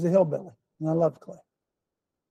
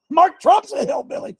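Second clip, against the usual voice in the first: much higher, gleeful rising hillbilly claim; playful exclamation; 12 semitones higher, 4 dB louder than usual. Acted. Preacher teasing his congregation about Mark Trump, high gleeful exclaim. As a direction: toss it up high like a joke to the crowd. Repeats line, pitched up playfully.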